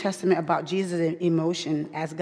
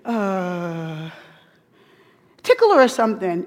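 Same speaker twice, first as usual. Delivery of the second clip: husband's bold command; 3 semitones higher, 6 dB louder than usual. Retold or imitated. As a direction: boom it out like a dad settling kids. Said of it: "He said".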